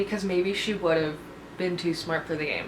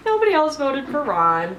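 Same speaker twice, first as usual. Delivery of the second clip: higher and louder, disbelieving; exasperated outburst; 7 semitones higher, 4 dB louder than usual. Real, not acted. Vlogger venting at her viewers about the vote, outraged. Repeats it, now loud and high.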